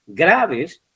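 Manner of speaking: "Graves" with GRA high, falling; emphatic GRA-ves; stress on first syllable. Shown as a demonstration. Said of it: "no es graves".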